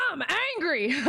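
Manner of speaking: high-pitched parody of anger; laughing mock anger. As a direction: blurt it high, breaking into laughter. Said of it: "I'm over here like".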